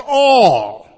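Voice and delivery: loud, ringing all; emphatic all. Acted. Preacher revealing the first Greek word, all said loud. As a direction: land all hard and loud.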